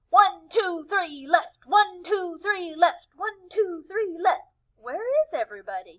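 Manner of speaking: high, loud cheer that trails off confused; Tacky marching off the wrong way; lost penguin cheer. Acted. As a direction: call it out then look around lost. Tacky lost, calling cheer alone.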